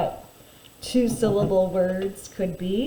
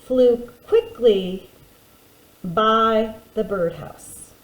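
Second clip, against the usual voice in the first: slow dictation; slower than usual. Shown as a demonstration. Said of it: "So let's write that".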